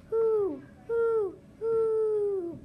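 loud, high owl hoots; ringing owl call, held notes. A narrator's reading. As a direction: hoot long and high like an owl.

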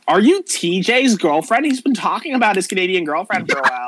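put-on Valley girl climbing in pitch with excitement; gossipy Valley girl. Valley girl asking if she is TJ's girlfriend, gossipy.

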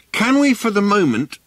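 high calling voice; called. A narrator's reading. Ford calling to Prosser, high and carrying. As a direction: call it across to him. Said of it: "called Ford".

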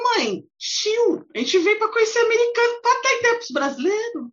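high, exasperated plea to mom; exasperated daughter. Retold or imitated. Daughter to mom in the queue, exasperated high plea. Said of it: "Aí eu ficava".

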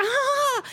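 high collective gasp, acted out; shocked gasp. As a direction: mimic scandalized kids gasping. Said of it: "everyone's like".

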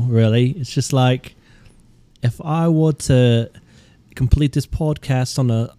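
slow, halting mock London ramble; hammed-up fake Londoner; terrible British accent. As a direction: ramble slowly in a badly faked British accent. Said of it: "those are terrible accents".

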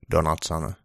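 ordinary delivery, nothing marked

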